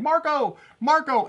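high, loud playful call; mock yelling. Lost drone calls for its controller, mock yell. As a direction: call out like a lost player of Marco Polo. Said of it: "where it's yelling".

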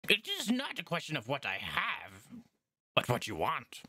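high, eager goblin shopkeeper voice; peppy goblin salesman; goblin merchant. Acted. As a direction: chirp it like a pushy little goblin trader.

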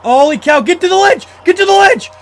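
very high, loud frantic commands; panicked yelling. Real, not acted. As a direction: scream the escape. Panicked escape in game.

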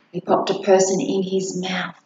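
plain reading voice

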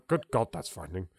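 ordinary talk

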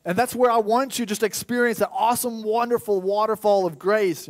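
joyful overflowing. Acted. God inviting his people to his fountain, joyful. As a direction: pour it out like a flood of joy. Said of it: "So God here's like".

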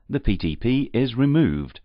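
ordinary talk

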